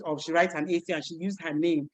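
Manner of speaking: ordinary delivery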